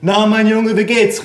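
high, cheery repeated greeting; grandma calling out. A narrator's reading. Forgetful grandma greeting her grandson, calling out. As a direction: call it out high as an old lady. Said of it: "rief sie".

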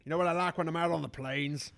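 grumpy cowboy farmer, low and gruff; drawling rural farmer, grumbling; old farmer voice. Acted. Plays old farmer figure.

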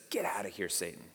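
low, soft dismissal; quiet rebuke. Retold or imitated. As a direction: send him off softly, voice dropped. Repeats Jesus's quiet rebuke.

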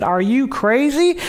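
slow, high, stretched disbelief; playful mock disbelief; incredulous. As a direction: draw it out in amazement. Preacher astonished by lady.

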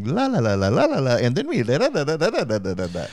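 rising la la la like a lullaby; mock Australian sing-song on la la; sing-songy lullaby lilt. Shown as a demonstration. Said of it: "the way they talk is kinda like a lullaby".

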